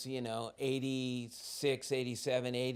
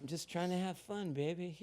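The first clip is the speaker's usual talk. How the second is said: softer, sheepish excuse; 3 semitones higher, 7 dB quieter than usual. Retold or imitated. Him to his girlfriend, a soft sheepish excuse. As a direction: mumble it sheepishly.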